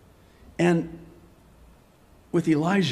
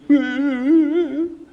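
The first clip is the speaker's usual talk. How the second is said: high, wavering mock whimper; mock self-pity; 9 semitones higher, 4 dB louder than usual. Preacher at his own pity party, high mock whimpering. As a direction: put on a drawn-out pretend sob. Mocks feeling sorry for himself.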